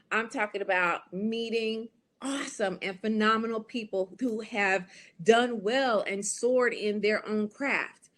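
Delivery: ordinary talk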